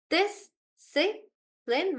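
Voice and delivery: three spaced beats on "Discipline"; syllable by syllable. Shown as a demonstration. Teacher confirming a student's answer, syllable by syllable. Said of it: "we break the word into syllables".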